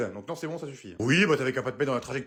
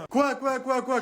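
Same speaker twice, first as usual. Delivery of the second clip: startled; 8 semitones higher, slower than usual. Acted. Husband startled by yelling.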